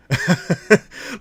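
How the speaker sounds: a sudden high, loud laugh; bright burst of real laughter. Real, not acted. Laughing at own remark.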